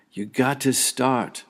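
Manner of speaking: drawn-out playground call, high and loud. Acted. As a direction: holler it slowly to a teammate. Soccer players' shout repeated.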